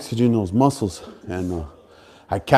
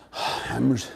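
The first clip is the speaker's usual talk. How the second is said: normal talking voice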